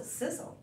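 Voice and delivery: hissed, voiceless and soft; sizzle. Pretend. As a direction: hiss it like frying. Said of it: "like an egg in a frying pan".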